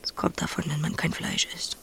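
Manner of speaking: soft, half-whispered remark; quiet. Acted. Emma mutters at sick Max, quietly. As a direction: murmur it under your breath.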